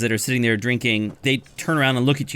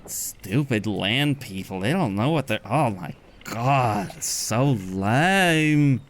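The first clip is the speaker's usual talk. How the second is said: slow, mumbled mocking sneer; blubbery Triton sneer; much slower than usual. Tritons keep sneering at the party, blubbery slow voice. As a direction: drawl out so lame with scorn. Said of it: "they're talking like that".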